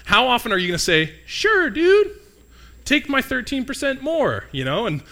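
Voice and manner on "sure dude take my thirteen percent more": much higher, hammed-up cheerful yes; mock eager taxpayer. Mocking a taxpayer who gladly gives the government more money, high and hammy. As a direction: squeak out a cartoonish eager agreement.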